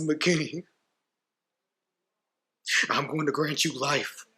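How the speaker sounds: high, strained courtroom plea; anguished grief. Acted. Bereaved parent granting the killer life, high strained grief. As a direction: push the words out through grief, pitch climbing.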